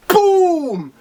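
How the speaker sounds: high, drawn-out explosion noise; imitated boom. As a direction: boom it out like a blast. Imitates the impact.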